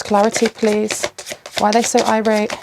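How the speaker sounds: normal talking voice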